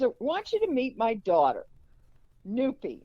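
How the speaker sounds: "Noopy" set apart after a pause; high-pitched introduction, landing pointedly on "Noopy"; stress on Noopy. Retold or imitated. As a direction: present "Noopy" as a separate, emphasized name. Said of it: "she emphasizes Noopy when she says it".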